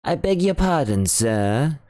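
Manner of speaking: polite butler surprised by the doctor; butler Parker. Acted. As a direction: play the startled servant.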